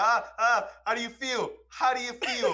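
high-pitched teasing crow; mock gloating laugh. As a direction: imitate a friend rubbing it in. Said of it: "And he starts laughing".

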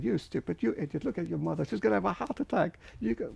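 high-pitched imitation of scolding neighbours; mock scolding. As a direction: pile on reproaches in a high, agitated pitch. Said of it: "they too started".

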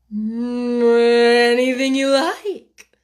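slow, drawling footman; unhurried servant, no interest at all. A narrator's reading. Footman voice in story.

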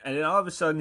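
ordinary talk

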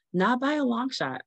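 pitched well up for emphasis; high emphatic denial. Real, not acted. Mother on her ruined shopping plans, high emphatic denial. Repeats the line, first time high.

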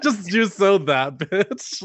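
slow words through laughter; laughing it out. Real, not acted. Laughing while retelling.

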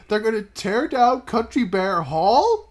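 slow, high mock disbelief; incredulous question. Bear hearing the hall will be torn down, mock incredulous. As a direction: draw it out, climbing into disbelief. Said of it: "he's like, he's like".

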